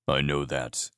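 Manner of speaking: low, quiet grunted reply. A narrator's reading. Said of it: "Kier grunted".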